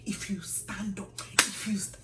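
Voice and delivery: acted threat, drawn out slowly; slow warning. Senior warning kneeling junior not to rise, slow threat. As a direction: warn slowly with mock menace. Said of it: "this girl threatens my life".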